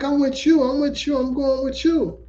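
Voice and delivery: high, insistent woman's voice; clingy woman. Retold or imitated. Stranger in the cab insists she's coming, high and pushy. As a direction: play the woman refusing to leave. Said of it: "She like".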